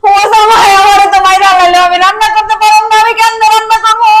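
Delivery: high, loud mock lament; wailing plea. Wife wails to her husband that she cannot cook, loud lament. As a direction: wail it at the husband like the world is ending.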